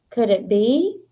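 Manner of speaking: ordinary delivery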